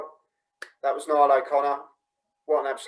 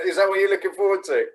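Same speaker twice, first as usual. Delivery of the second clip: laughing; 6 semitones higher, 3 dB louder than usual. Real, not acted. Host laughs at cheeseburger craving.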